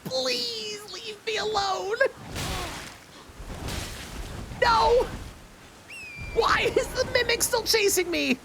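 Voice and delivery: high, frantic pleading while fleeing; desperate panicky squeal, drawn out; being chased. Real, not acted. Fleeing a mimic in game.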